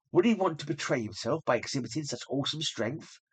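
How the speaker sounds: usual speaking voice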